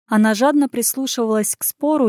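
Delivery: plain reading voice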